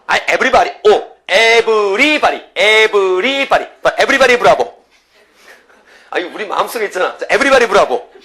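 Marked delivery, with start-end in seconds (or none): 0.1-4.8 loud, high, stretched-out hype shout; rousing crowd call. Shown as a demonstration. Teacher whipping everybody into a bravo cheer, rousing shout. 7.2-8.1 loud, high-pitched group cheer; rallying shout. Shown as a demonstration. Teacher calling everybody to join the bravo, rallying shout. Teacher rallies class once more.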